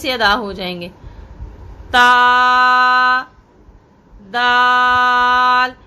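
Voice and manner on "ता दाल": ता, दाल sounded long and slow; drawn-out letter names. Shown as a demonstration. Teacher sounding letters for students, drawn out. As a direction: stretch दाल out, recitation style. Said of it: "इसको बड़ी नरमी से".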